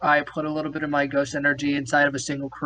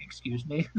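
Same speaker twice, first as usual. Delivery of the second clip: stunned quiet; 4 semitones lower, 11 dB quieter than usual. Retold or imitated. Shocked reply, very quiet.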